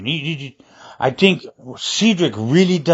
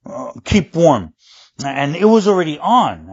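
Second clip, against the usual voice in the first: ordinary talk, nothing marked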